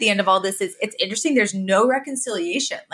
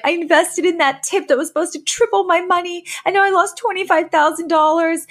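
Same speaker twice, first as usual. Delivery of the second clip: high, whiny mother complaining about lost money; hammed-up wail of an old mom; distressed mother; 10 semitones higher than usual. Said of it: "she's like".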